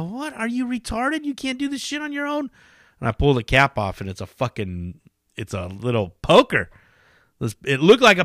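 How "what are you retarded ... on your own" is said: incredulous mock. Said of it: "I go".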